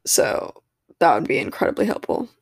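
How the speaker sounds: normal talking voice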